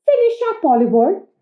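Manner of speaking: high, loud mum voice; hurrying mum. Acted. Mum hurrying Oliver for the bus, high and loud. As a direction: hurry the child along in a high voice. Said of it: "said his mum".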